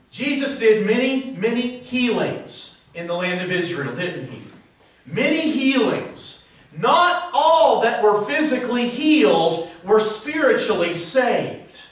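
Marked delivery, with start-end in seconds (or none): none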